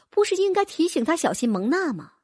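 plain reading voice

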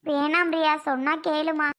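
ordinary talk, nothing marked

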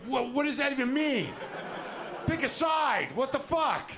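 mock-outraged high voice demanding a side; exasperated believer. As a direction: throw your hands up at them, pitched high. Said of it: "They're like".

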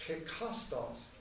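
hekastos said slowly, each syllable clear; slow careful Greek hekastos; Greek word. Shown as a demonstration. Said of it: "it's the Greek word".

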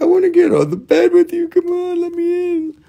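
very high, whiny doggy voice; crying dog. Retold or imitated. Dog begging to get on the bed, crying squeaky voice. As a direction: squeal it like a pleading pup. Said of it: "He cries. He's like".

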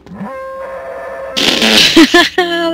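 high giggle. Real, not acted. Laughing at Chewbacca with Leia hair.

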